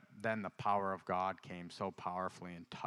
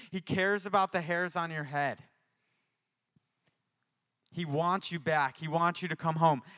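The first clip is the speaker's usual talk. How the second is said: raised, fervent sermon voice; preaching; 7 semitones higher, 6 dB louder than usual. Retold or imitated. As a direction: proclaim it to everyone around. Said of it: "I start preaching".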